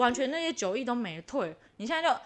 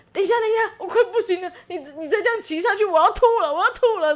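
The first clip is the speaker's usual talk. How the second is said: higher, louder pleading to stop the bike; mock desperate plea; 6 semitones higher, 6 dB louder, faster than usual. On the bumpy scooter, begging her husband to stop, loud and urgent. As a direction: play it like you're about to be sick on the scooter.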